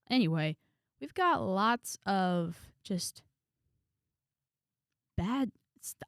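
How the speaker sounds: ordinary delivery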